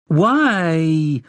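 put-on high drawn-out child's whine; whining. A narrator's reading. Girl protesting to her mother, whining. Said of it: "Hedda whined. She had that whine down".

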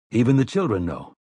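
ordinary delivery, nothing marked

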